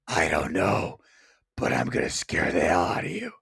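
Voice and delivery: low menacing ghostly voice; haunting voice. Retold or imitated. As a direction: threaten in a deep spectral voice. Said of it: "My voice".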